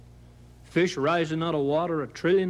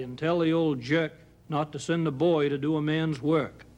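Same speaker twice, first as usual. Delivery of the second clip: plain reading voice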